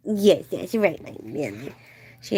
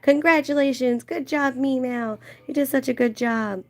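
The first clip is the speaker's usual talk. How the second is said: family's mock praise; 8 semitones higher than usual. Family's texted replies retold.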